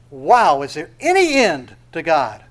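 loud, amazed exclamation; awestruck. Acted. Someone looking at the heavens, loudly awestruck. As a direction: let the wonder burst out.

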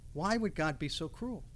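ordinary talk

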